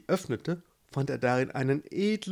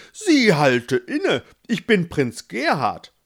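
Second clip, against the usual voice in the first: slow, louder, grandly royal; haughty; 6 semitones higher, 8 dB louder than usual. Acted. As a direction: announce yourself like a pompous prince. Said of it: "antwortete hoheitsvoll". (hoheitsvoll und langsam, lauter, wie ein eitler Prinz)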